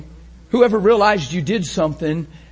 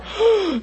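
shocked gasp; 13 semitones higher than usual. Retold or imitated. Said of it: "They're going".

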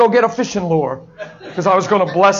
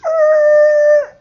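sudden cry; 7 dB louder than usual. Acted. God stops her in her tracks.

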